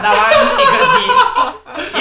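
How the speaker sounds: high, loud, laughing through the words. Real, not acted. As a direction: giggle it out in disbelief. Laughing about a grappa served.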